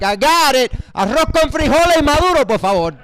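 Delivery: put-on loud, high Cuban Spanish accent ordering; extra Cuban accent. Comedian ordering in Cuban Spanish accent. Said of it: "So I'm like".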